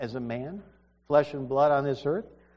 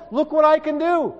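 God proclaiming power; 12 semitones higher, 8 dB louder than usual. Acted. God showing His power.

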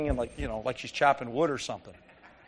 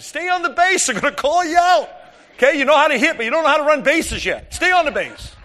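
much higher and louder shout across the field; coach yelling; 11 semitones higher, 9 dB louder than usual. Retold or imitated. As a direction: yell to the runner from the dugout. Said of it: "and I'm yelling".

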